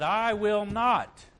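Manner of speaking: loud, slow and high, each word hammered; I will not slowly and loudly; defiant vow. Retold or imitated. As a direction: vow it like you would never break. Peter's vow, quoted.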